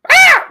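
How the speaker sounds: high piercing goat scream; screaming goat bleat. Shown as a demonstration. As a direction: let out a wild goat shriek. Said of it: "There's my Screaming Goat impression".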